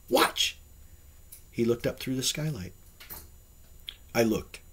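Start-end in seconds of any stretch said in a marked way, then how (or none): 0.1-0.6 short urgent exclamation; excited cry. A narrator's reading. Man urging his guest to watch the sky, excited cry. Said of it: "he cried".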